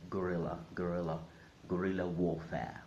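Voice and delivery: guerrilla like gorilla, guh-RIL-uh; English pronunciation. Shown as a demonstration. Teacher showing accepted English usage, guerrilla as guh-RIL-uh. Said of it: "in English, it's perfectly all right".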